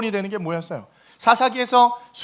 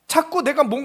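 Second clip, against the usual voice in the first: usual speaking voice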